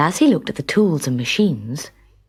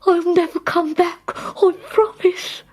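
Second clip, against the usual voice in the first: high, crying promise; tearful pleading; 13 semitones higher than usual. A narrator's reading. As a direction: beg through tears. Waldorf pleads with the king.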